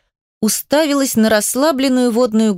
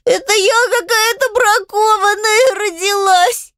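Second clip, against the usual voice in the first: very high, slow crying wail; high, tearful self-blaming whine; sobbing child; 11 semitones higher, slower than usual. A narrator's reading. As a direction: bawl it out in a little girl's voice. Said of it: "зарыдала Адель".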